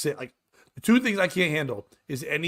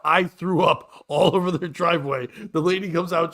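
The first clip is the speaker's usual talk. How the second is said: ordinary talk, nothing marked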